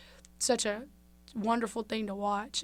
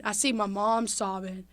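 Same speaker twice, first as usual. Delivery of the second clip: ordinary delivery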